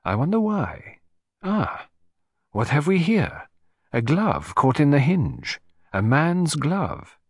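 curious sleuth, lingering on the find; intrigued detective. Acted. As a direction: puzzle it out slowly as you discover a clue. Narrator voices detective finding clue.

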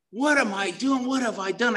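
high-pitched replayed panic; retold meltdown. Man in psychotic meltdown after drug deal, high replayed panic. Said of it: "I am absolutely in meltdown".

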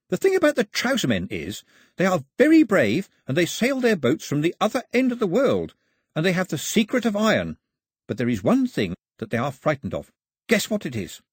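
high, animated explainer; lively Pilou. Acted. Pilou translates the song for Mao, high and eager. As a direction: explain it eagerly, pitched well up.

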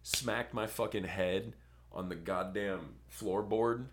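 ordinary talk, nothing marked

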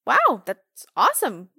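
surprised. Retold or imitated. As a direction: act surprised at the good news. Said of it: "They're like".

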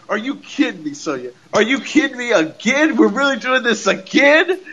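high exasperated outburst; retelling Liv Morgan's angry reaction to Sonya; imitating angry Liv. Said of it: "Liv Morgan was like".

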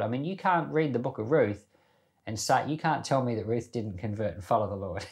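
normal talking voice

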